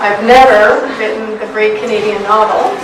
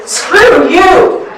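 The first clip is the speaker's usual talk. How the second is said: angry outburst; 7 semitones higher than usual. Acted. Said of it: "It is not my voice".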